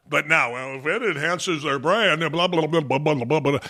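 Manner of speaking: low, soft sneer at the brand line; mocking corporate voice. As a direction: trail off into dismissive blahs.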